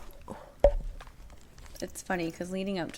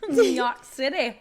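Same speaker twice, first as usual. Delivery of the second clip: put-on New York accent; 5 semitones higher, 9 dB louder than usual. Playful loud New York announcement.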